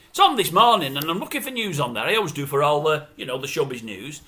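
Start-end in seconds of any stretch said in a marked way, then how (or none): none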